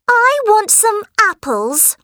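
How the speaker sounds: put-on high whiny demanding girl; spoiled girl voice. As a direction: whine it high like a brat. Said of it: "said Helen".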